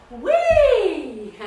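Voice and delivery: loud, high squeal of delight with a laugh; gleeful whee. Acted. Mouse hopping onto beach, loud delighted squeal. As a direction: squeal like sliding down a hill.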